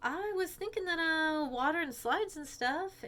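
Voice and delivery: put-on high, slow excited girl voice; Peach voice. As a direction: Peach pitching the slides, high. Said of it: "So Peach says".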